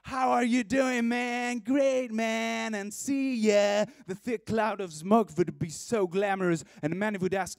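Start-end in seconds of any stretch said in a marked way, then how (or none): 0.0-3.8 high shouted hello over the music, and see ya drawn out slowly; mock shouted greeting. Bumping into a friend in the crowd, mock shouted greeting. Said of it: "and you'd shout out".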